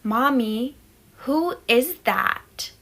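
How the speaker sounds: put-on small child's slow, puzzled question; toddler's question. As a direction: stretch it out slowly like a small child asking. Toddler son quoted, scary story.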